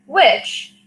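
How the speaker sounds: ordinary delivery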